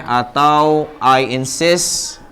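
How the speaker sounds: "I insist" drawn out very slowly; slow teacher model of "I insist"; slow example phrase. Shown as a demonstration. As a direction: dictate "I insist" slowly for the class.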